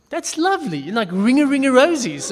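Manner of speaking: high, bright mock innocence; naive delight. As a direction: sound delighted at a children's game. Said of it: "And I thought".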